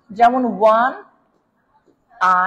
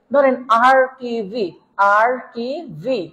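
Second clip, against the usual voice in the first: RTV drawn out very slowly; slow dictation; much slower than usual. Shown as a demonstration. Teacher writing an example for students, very slow dictation. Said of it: "আমি যদি আপনার এখানে একটু দেখাই".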